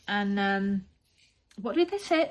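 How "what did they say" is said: ordinary delivery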